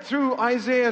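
ordinary talk, nothing marked

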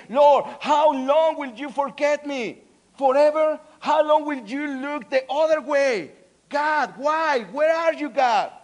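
loud, high desperate plea to God; anguished cry. Acted. Let's read again Psalm chapter thirteen.

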